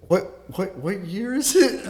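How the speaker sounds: high-pitched, puzzled retelling; retold bewilderment. Coma patient asking the nurse the year, retold bewilderment.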